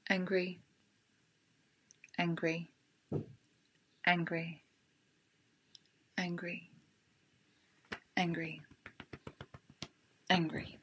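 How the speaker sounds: rhythmic chant-like repetition fading out; tapping repetition. Shown as a demonstration. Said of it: "tap along with me and repeat what I say".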